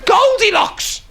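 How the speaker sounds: very high, drawn-out disbelief; high-pitched comic outrage; incredulous rant. Real, not acted. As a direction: squeal the absurd plot, slowly. Incredulous rant about pantomime story.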